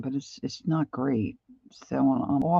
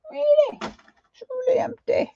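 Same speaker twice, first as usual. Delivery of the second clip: mock dismay. Mock dismay at empty paint tube.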